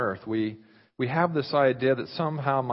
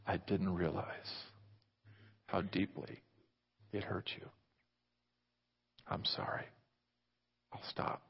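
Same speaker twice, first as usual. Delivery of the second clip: whispered apology; 5 semitones lower, 12 dB quieter than usual. Acted. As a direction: confess it in a hush. Church's whispered apology to groom.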